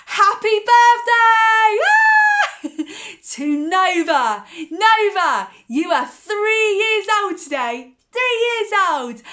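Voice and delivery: high, loud birthday cheer; shouting happy birthday to Nova; joyful birthday shout. Real, not acted.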